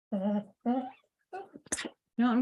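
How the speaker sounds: slow conspiratorial whisper; playful whisper. Acted. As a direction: lean in and whisper the secret plan. Teasing her drawing plan.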